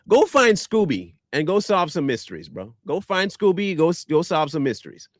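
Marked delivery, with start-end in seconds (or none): none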